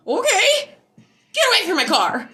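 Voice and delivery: high, loud, jumpy "get away" yell; startled yell. Retold or imitated. To the neighbor behind her car, a high startled yell. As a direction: shoo him off in a high startled shout.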